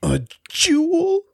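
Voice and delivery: very high, drawn-out greedy question; mock awe. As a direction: coo it high and slow like a tempted thief.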